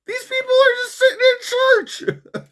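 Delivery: squeaky high disbelief, half crying; incredulous. Retold or imitated. Vlogger reacting to church protest in shrill disbelief. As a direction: squeal it like you can't believe it. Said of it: "I'm like".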